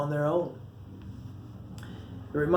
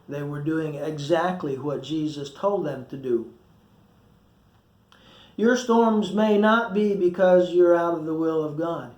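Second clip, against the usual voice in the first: normal talking voice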